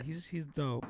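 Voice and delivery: normal talking voice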